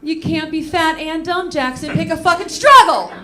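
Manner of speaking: put-on loud, high exasperated yell at the kid; mock angry yell. Mom yelling at her son Jackson, loud exasperated mock rage. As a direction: snap it at him like a fed-up mom.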